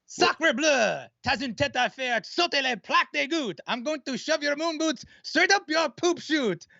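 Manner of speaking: put-on high, over-the-top French accent; hammy French accent rap; french chef. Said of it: "I want Alexander the Great".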